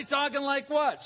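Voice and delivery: lightly hammed-up Southern accent. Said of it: "you got a southern accent".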